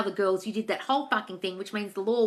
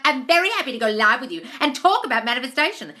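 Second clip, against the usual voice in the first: raised, louder fake-polite mimicry; mocking sweet voice; 4 semitones higher, 7 dB louder than usual. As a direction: sneer at her sugary offer. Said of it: "she was like".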